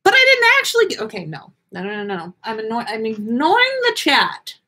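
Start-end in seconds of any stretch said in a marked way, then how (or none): none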